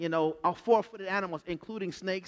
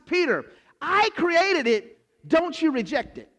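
slow, higher, louder rebuke; stern God; 9 semitones higher, 5 dB louder than usual. Acted. God correcting Peter, slow and emphatic. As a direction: lay down the law deliberately.

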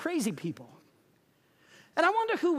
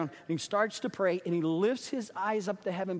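ordinary talk, nothing marked

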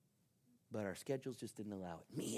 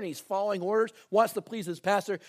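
usual speaking voice

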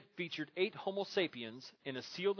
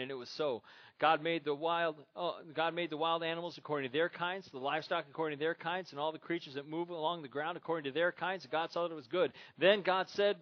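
louder, raised preacher's reading voice; Scripture reading; 3 semitones higher, 4 dB louder than usual. Acted. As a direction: read the verses out to the congregation. Reads Scripture aloud to congregation.

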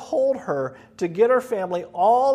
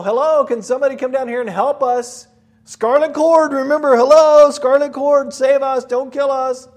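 yelling for help from inside the wall, much louder and higher; panicked cries for rescue; frantic shouting; 5 semitones higher, 8 dB louder than usual. Acted. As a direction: shout up desperately, begging not to be killed. Rahab's family yelling from wall.

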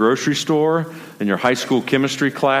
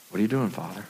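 soft, unhurried question in prayer; dialed-down prayer; 6 dB quieter, faster than usual. Shown as a demonstration. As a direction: quietly ask and leave room to listen. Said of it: "Dial down".

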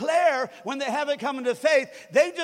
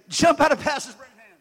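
loud command; 5 semitones higher, 9 dB louder than usual. Shown as a demonstration. Pastor showing a commanding declaration, loud. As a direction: bark it up high and loud.

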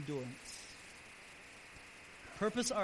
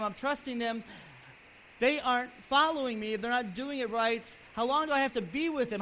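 exasperated, louder and higher; frustrated Jesus; 5 semitones higher, 7 dB louder than usual. Retold or imitated. Jesus fed up with his faithless disciples, with exasperated, raised voice. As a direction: vent the weariness of years of teaching. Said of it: "It's almost like he's just frustrated".

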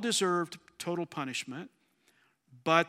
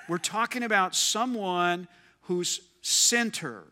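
ordinary delivery

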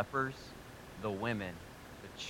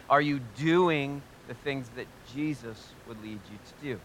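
loud, driving sermon delivery, pitch lifted; full-force preaching; 4 semitones higher, 8 dB louder than usual. Acted. Pastor exhorting his congregation, loud and driving. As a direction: preach it hard to the room.

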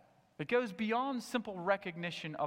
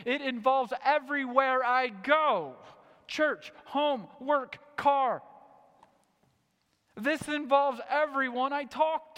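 louder and higher, driving the point home; full-force preaching; 8 semitones higher, 7 dB louder than usual. Acted. Pastor pressing the congregation, loud and higher. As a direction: preach it hard to the whole room.

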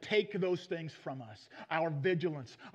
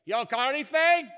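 hollering; 2 semitones higher, 9 dB louder, slower than usual. Acted. Jesus calling to fishermen from the shore, hollering. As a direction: holler it out to people far off. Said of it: "Jesus kinda hollers to them from the shore".

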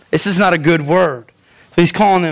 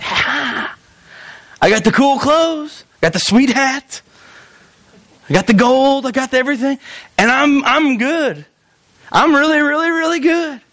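mock boastful; 7 semitones higher than usual. Pharisees boasting to God and other people, mock-smug. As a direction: show off each item in a high, stretched voice.

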